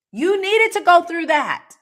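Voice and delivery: high, loud, insistent declaration; emphatic insistence. Real, not acted. Coach absolving women of their past selves, emphatic insistence.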